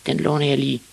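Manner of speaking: put-on high-pitched boy voice; casual kid, voiced high; little boy Ivan. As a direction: speak up high as a small boy. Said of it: "sagde Ivan".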